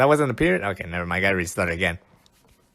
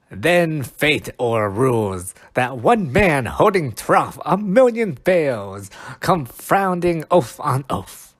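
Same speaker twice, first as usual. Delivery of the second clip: slow, louder, declaiming each word; dramatic Shakespeare reading; 4 semitones higher, 4 dB louder, slower than usual. Acted. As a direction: proclaim it like a stage actor. Reading Puck's lines aloud.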